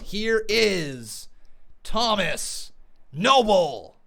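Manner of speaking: drawn-out ring-announcer build to the caller's name; announcer intro. Acted. Host hyping up the next caller on his call-in show, booming announcer. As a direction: stretch it out like introducing a wrestler.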